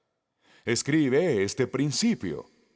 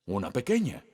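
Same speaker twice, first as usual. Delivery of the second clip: normal talking voice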